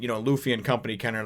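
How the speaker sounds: ordinary delivery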